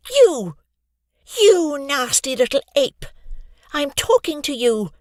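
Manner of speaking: put-on girl yelling at the bully over the lunchroom noise. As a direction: shout him down across the cafeteria. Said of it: "Raising her voice over the cafeteria's noise".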